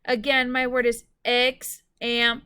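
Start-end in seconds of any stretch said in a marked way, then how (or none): 1.2-2.4 "example" broken into three loud beats; syllable by syllable. Shown as a demonstration. Teacher repeating the word for spellers, "example" split syllable by syllable. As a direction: sound out "example" with gaps between the syllables. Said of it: "Let's tap out the syllables for example".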